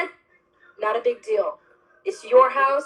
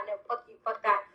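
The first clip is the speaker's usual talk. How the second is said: ordinary talk, nothing marked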